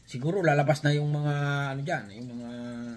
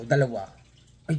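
normal talking voice

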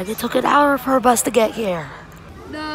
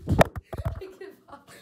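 whispered; 5 semitones higher, 5 dB quieter, much slower than usual. Real, not acted. Filming quietly in bathroom.

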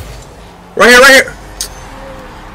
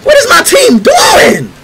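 furious crying shout. Real, not acted. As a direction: scream in real frustration. Raging at teammates.

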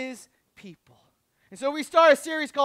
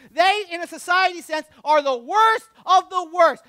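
loud, high-pitched preaching; preaching at full force; 6 semitones higher, 6 dB louder than usual. Real, not acted. Preacher laying out two crowds to the congregation, impassioned and loud. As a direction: punch it out to the congregation, higher and louder.

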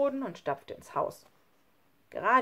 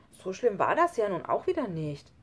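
ordinary delivery, nothing marked

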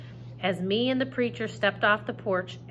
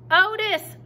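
high, loud call of his name; hollering; 12 semitones higher, 7 dB louder than usual. Acted. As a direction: holler across the rainy garden. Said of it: "I hollered at him over the rain".

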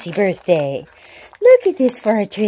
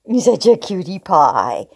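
ordinary delivery

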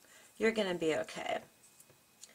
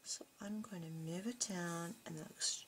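whisper; 11 dB quieter than usual. Real, not acted. Unvoiced trailing aside.

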